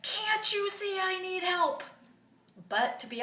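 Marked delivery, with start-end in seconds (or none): 0.0-1.8 high-pitched, drawn-out mock yell at husband; exasperated yell. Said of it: "I wanted to yell at him".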